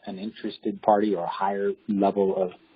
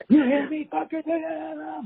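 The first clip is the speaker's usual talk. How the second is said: very high, strained mock scream; shrieked threat, imitated; imitating screaming man; slower than usual. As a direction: mimic a lunatic still yelling. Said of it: "still screaming".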